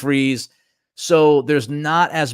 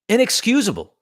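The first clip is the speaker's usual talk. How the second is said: pitched high, each word dropped in disgust; high, exasperated verdict; 7 semitones higher than usual. Real, not acted. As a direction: spit it out like you can't believe it. Ranting about a missed call.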